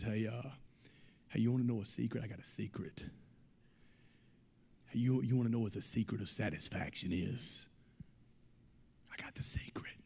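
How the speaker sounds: soft, leaning-in secret-telling, fading to a whisper. Retold or imitated. David leaning over at the table to tell a secret, hushed whisper. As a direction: lean close and share it confidentially. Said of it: "whispers in her ear, and he says".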